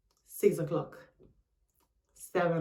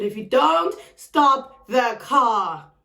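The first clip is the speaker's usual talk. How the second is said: very slow, raised, word-by-word threat; loud, drawn-out ultimatum; retold ultimatum; 4 semitones higher, 6 dB louder, much slower than usual. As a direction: lay down a warning one word at a time.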